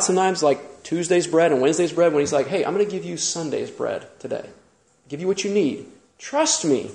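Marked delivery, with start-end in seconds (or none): 2.5-5.9 soft, hushed, tender promise; softly reassuring God, a touch understated; gentle reassurance. Retold or imitated. Preacher voices gentle God. 6.2-6.9 quiet, tender "Trust me"; hushed, gentle "Trust me"; soft trust me. Retold or imitated. Preacher voices gentle God.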